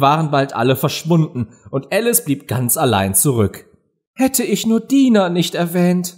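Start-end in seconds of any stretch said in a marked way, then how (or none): 4.1-6.1 high, sorrowful regret; downcast. A narrator's reading. Alice is left alone after mentioning Dina, downcast. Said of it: "sagte sie betrübt".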